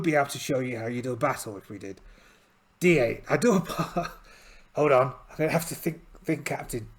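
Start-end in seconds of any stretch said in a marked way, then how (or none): none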